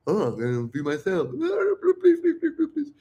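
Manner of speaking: whimpering mock misunderstanding that turns to squeaky begging; put-on crybaby babble; mocking needy men. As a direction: parody a pathetic pleader, jumping very high. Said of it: "they're like".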